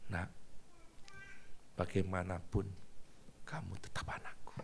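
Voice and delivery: soft, near-whispered fatherly comfort; tender father. Acted. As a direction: hold your child and reassure him quietly.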